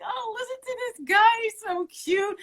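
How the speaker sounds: high, swooning gush over a cute cook. Retold or imitated. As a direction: coo it like a smitten fan. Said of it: "I was just like".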